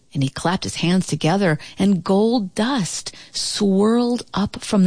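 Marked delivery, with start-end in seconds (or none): none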